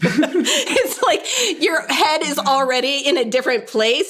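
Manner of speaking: amused, laughing as she says it; teasing her friend, laughing. Real, not acted. Joking, laughing together.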